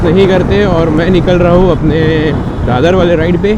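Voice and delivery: projecting hard as he rides off; loud over the road. Real, not acted. Vlogger setting off on his ride, shouting over the wind.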